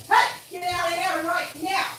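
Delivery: high, sharp call to chase them off; shooing squirrels. Real, not acted. Shouting at the squirrels to get out, high and sharp. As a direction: yell out the window at pests.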